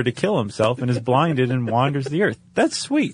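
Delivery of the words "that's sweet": mock sweet. Sarcastic remark, high far voice.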